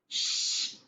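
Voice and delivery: sharp unvoiced shush; a gnome hushing its friends. A narrator's reading. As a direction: hush the others fast. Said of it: "said a third tiny voice".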